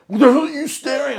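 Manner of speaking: loud, high mock outburst; retold angry challenge. Friend challenging the people staring at him, angry.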